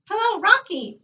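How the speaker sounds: high squawky parrot call. A narrator's reading. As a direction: squawk it out like a talking bird. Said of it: "answered the parrot".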